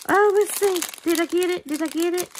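breathless hope, leaning in; eager anticipation. Real, not acted. Checking the strand, eager.

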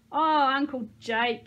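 put-on loud, high, drawn-out girlish squeak. A narrator's reading. Caroline delights in Uncle Jake's toppling over, squeaking. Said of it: "Caroline squeaked".

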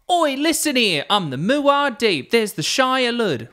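high, slow hammed-up Cockney; Cockney geezer rant, high and drawn out; Cockney nut job. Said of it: "a Cockney nut job".

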